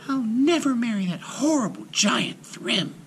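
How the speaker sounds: furious scream. A narrator's reading. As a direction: shriek it in outrage. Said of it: "she screamed furiously".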